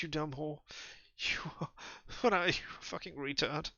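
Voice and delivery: high, far-off, manic voice; unhinged rant. Acted. Raving man sneering at Julia. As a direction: spit out insults like a madman.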